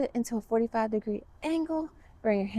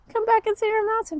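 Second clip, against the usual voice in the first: sing-song; 12 semitones higher, 4 dB louder than usual. Acted. Kids yoga, sing-song cue.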